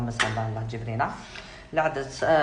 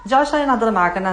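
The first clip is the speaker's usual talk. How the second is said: much higher, louder mock-indignant cry; teasing rebuke of a critic, louder and higher; indignant outburst; 7 semitones higher, 11 dB louder, faster than usual. Real, not acted. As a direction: snap back at the viewer, higher and loud. Rounding on a heckling viewer.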